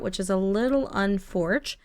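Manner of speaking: ordinary talk, nothing marked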